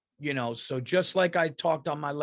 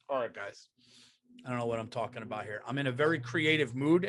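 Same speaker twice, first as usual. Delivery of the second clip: ordinary talk, nothing marked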